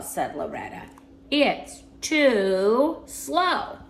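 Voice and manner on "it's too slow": extremely drawn-out words with pauses; deadpan punchline. A narrator's reading. Loretta explains rejecting the wheelchair, very slow punchline. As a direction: stretch every word for the punchline.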